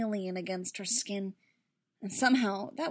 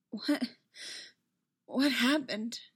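faltering, higher-pitched dazed question; raw, distant; 3 semitones higher, slower than usual. A narrator's reading. Padme coming back to herself, raw and distant. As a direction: sound as if unused to speaking. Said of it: "Her voice sounded raw and distant".

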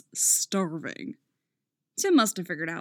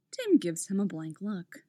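usual speaking voice

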